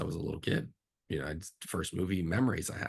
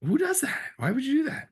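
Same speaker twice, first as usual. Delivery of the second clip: high, loud outraged squeak of disbelief; retold disbelief; 3 dB louder than usual. Director recalling the clashing schedule, high incredulous squeal. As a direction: shriek it like you can't believe it.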